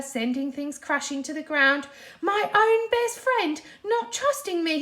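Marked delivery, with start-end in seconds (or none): 2.2-4.8 high, loud hurt yell; angry yell. A narrator's reading. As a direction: shout it out in outrage. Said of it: "he yelled".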